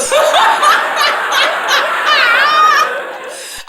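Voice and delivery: long high cackle near tears; real helpless laughter; high wheezing laugh. Real, not acted. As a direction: laugh till it hurts. Cracking up at story.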